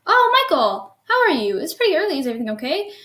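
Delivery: lightly acted surprised mom on the phone; surprised mother. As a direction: greet the caller high and loud, then settle into concern. Mother-in-law answers early phone call.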